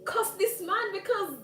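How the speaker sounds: high, loud, indignant telling-off; indignant retort. Retold or imitated. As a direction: scold him in a heated, high-pitched outburst. Acted angry retort to the man.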